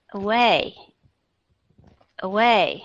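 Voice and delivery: away with a quick, weak first a; barely touch the a- of away; away, first vowel a faint uh; light first schwa. Shown as a demonstration. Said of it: "âm ờ nó nhẹ".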